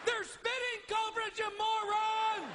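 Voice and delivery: high exasperated yell, last word dragged out; comic full-pitched scream. Retold or imitated. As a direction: yell at an idiot on television. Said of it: "I am screaming at my television set".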